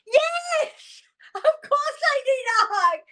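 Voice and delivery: high, sobbing cry of yes, voice cracking; tearful cry. Retold or imitated. Walker in tears accepts a stranger's hug, sobbing and high. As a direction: let it burst out through tears.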